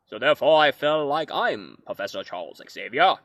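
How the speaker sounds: a grand, wise mentor voice; Professor X impression. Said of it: "That's my impression of Professor X".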